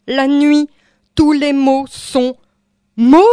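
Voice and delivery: high, loud, stretched-out words; ringing poetic shout; declaimed outcry. Acted. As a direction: cry it out word group by word group, rising at the end. Poem builds to an outcry.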